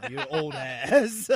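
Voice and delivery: high, loud, slow laughing taunt; mock jeer dissolving into laughter. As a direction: crack up mid-insult. Mocking the bouncer, laughing.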